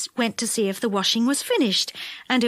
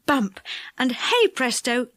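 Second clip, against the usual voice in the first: plain reading voice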